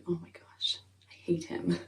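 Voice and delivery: breathy whisper, real disgust; whispered aside. Real, not acted. Fan whispering her disgust at the creepy judge, hushed whisper. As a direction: whisper it like a secret you can't hold in.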